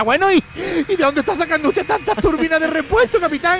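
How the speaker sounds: put-on high, pinched old lady; lady passenger's mock-innocent question; fussy passenger. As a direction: needle the captain in a squeaky voice. Passenger's third question to captain.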